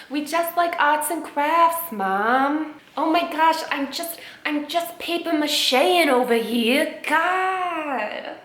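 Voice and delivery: high, drawn-out mock-innocent whine; whiny protesting kid. Kid telling Mom it's just crafts, whiny mock innocence.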